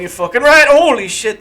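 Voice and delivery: loud, high cursing outburst; startled yell. Real, not acted. Player startled by a rat, loud cursing.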